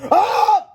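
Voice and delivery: very high, loud scream; terrified scream. A narrator's reading. Boy chased by monster, screaming. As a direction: shriek in cartoon terror. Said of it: "screamed George".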